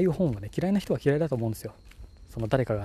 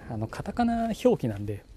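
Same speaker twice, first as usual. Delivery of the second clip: usual speaking voice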